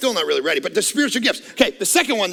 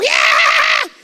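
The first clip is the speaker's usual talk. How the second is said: put-on high squeaky kid's cheer; excited kid; 6 dB louder than usual. Pastor imitating a five-year-old cheering, high and loud. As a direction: squeal it like a thrilled little kid. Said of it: "five-year-old kid would go".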